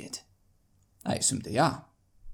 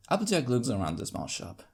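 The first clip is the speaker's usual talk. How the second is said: usual speaking voice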